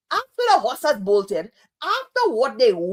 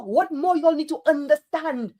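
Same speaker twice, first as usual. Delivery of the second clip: normal talking voice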